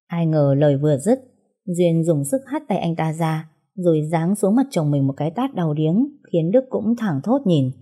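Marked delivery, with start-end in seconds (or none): none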